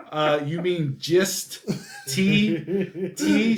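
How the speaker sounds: slow "gist", then a high, punched "T"; incredulous correction. Retold or imitated. Dad correcting daughter's word, incredulous correction.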